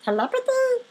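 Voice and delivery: high-pitched, questioning disbelief; creeped out. A narrator's reading. Visitor learning of telepathy, creeped out. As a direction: echo it high, in startled disbelief. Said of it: "Now I was really creeped out".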